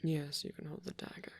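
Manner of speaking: intimate whispered roleplay. Acted. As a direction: whisper close and tender, like a shy caretaker.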